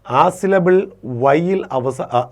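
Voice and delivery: ordinary talk, nothing marked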